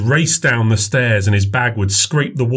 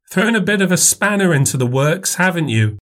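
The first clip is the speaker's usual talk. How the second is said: put-on older woman voice; 8 semitones higher than usual. A narrator's reading. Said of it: "mother teases son".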